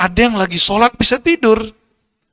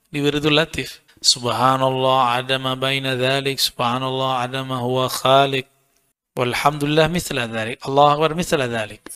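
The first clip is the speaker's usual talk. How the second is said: recited dhikr; 5 semitones lower, 3 dB quieter than usual. Shown as a demonstration. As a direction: intone each phrase calmly like wirid. Recites dhikr formulas slowly. (zikir dilantunkan pelan dan rendah)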